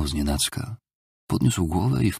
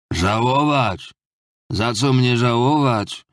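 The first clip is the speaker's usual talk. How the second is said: slow, drawn-out outcry, mildly raised; sudden cry; 7 semitones higher, 5 dB louder, slower than usual. A narrator's reading. Drunkard stands up, arm outstretched, in a mildly raised inspired cry. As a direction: cry out as if waiting for these words. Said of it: "wrzasnął znagła Marmiładow".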